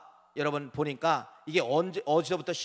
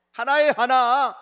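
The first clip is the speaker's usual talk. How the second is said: proclaimed; 6 semitones higher, 8 dB louder, slower than usual. Real, not acted. Pastor proclaims unity.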